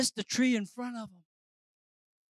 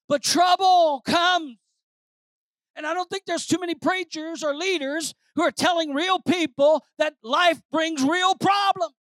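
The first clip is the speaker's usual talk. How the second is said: loud, raised-pitch sermon delivery; preaching at full force; 8 semitones higher, 9 dB louder than usual. Real, not acted. Preacher warning believers that trouble comes, full-force preaching. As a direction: drive it home to the congregation, punching key words.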